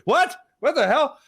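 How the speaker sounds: high-pitched, comic shocked questions; alarmed disbelief. Acted. Rider reacting to stranger's seatbelt warning, mock alarmed.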